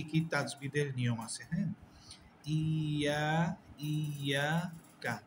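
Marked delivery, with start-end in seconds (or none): 2.5-5.2 ইয়াকা recited slowly, the first vowel stretched; slow tajweed demo of ইয়াকা; drawn-out Quranic recitation. Shown as a demonstration. Teacher demonstrating recitation of ইয়াকা. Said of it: "এইখানে তাজবিদটা কি?".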